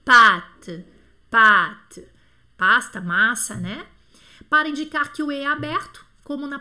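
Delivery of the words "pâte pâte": pâte said loud and low, the open A held long; lengthened A. Shown as a demonstration. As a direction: hold the A of "pâte" an extra beat. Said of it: "um tempo a mais aí".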